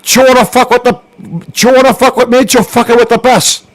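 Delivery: hammed-up Cuban gangster accent; Scarface impression. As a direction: growl it like Tony Montana. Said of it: "can you roll your R's".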